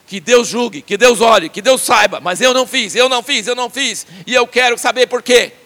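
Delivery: loud insistent vow; Job's final oath. Retold or imitated. Job's last speech demanding God explain, loud. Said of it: "ele fala assim".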